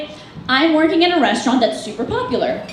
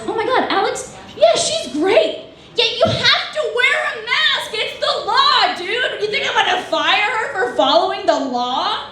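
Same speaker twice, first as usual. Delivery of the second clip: manager defending employee; 7 semitones higher than usual. Acted. Manager telling customer off for complaining, incredulous. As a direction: play the manager, pitched up and dismissive.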